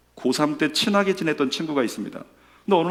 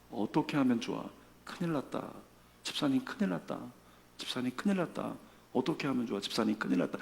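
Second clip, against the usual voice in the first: hushed, sympathetic fretting, much softer; empathizing; 3 semitones lower, 12 dB quieter than usual. Acted. Friend sharing a deacon's despair, empathizing under the breath. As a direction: fret quietly along with someone in trouble. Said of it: "공감해 주는 거죠".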